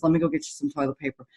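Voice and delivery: a hint of a flustered kind counselor. Counselor to crying client, hurried and helpful.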